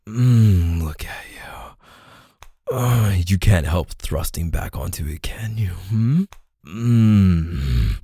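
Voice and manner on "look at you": slow whisper. Acted. Intimate roleplay with a lover.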